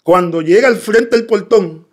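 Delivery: usual speaking voice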